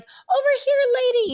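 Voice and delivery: put-on very high, loud sing-out to a baby; baby wrangler calling. Baby wrangler on set calling to the child, high calling. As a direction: call out high and loud to get a toddler's attention.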